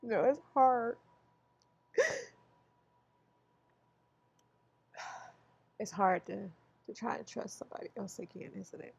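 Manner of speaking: choked up, fading to a near whisper; holding back tears, voice trailing away; emotional. Real, not acted. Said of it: "very emotional".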